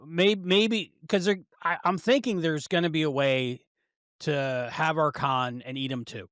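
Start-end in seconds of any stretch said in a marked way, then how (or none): none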